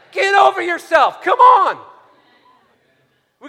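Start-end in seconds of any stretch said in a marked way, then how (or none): 0.1-1.8 loud, high shouted exhortation; preaching shout. Real, not acted. Preacher shouting at hesitant believers to act. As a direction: shout it at them to wake them up.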